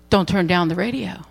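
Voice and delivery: low and soft, kind instruction; gently forbid, no pressure at all; ever so gently. Retold or imitated. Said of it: "ever so gently said".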